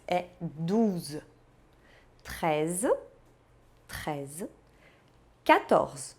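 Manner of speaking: usual speaking voice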